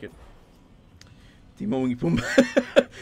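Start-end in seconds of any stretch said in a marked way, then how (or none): 1.6-2.9 put-on slow, playful Spanish accent, louder. Streamer jokingly naming Timon y Pumbaa, Spanish accent. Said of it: "I said it with a Spanish accent".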